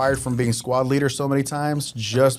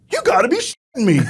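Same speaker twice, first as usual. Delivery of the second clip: incredulous first sergeant; 9 semitones higher, 4 dB louder than usual. Retold or imitated. First sergeant hears explanation.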